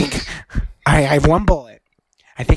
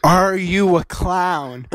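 slow suspicious question; much slower than usual. Acted.

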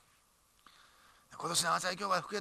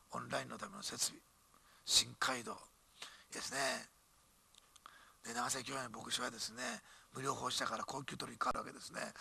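ordinary talk

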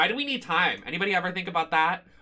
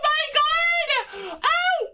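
startled scream; 5 dB louder, much slower than usual. Real, not acted. Startled by sudden scare.

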